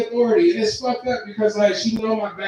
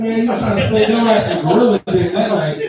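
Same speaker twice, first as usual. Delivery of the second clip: usual speaking voice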